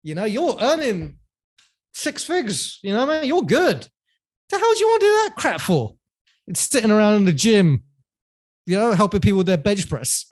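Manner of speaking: high, scoffing imitation of dad; mock the cautious father, pitch shooting up; risk-averse dad. Dad dismissing his son's wish to be a trainer, high scoffing imitation. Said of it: "my dad's risk-averse personality comes".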